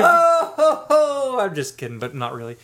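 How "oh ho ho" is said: loud, high drawn-out "oh-ho-ho"; jovial whooping. Real, not acted. Co-hosts joking about beer and truth, jovial whooping. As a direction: whoop it high through the laughter. Joking reaction amid shared laughter.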